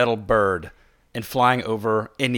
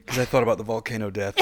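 ordinary talk

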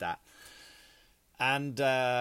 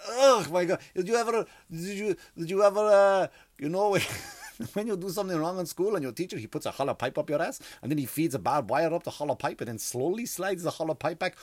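hammed-up Russian woman, mostly higher pitched; Russian accent; 5 semitones higher than usual. Russian nurse likening needle to school punishment, Russian accent. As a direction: act out a Russian nurse's grim storytelling. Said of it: "with a Russian accent".

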